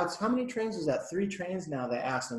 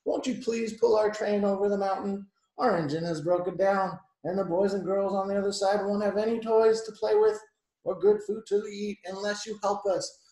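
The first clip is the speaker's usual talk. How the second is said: mildly raised begging voice; pleading cry; 5 semitones higher, 5 dB louder than usual. A narrator's reading. Dolls asking old engine for help, pleading. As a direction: plead on behalf of stranded toys. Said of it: "cried all the dolls and toys".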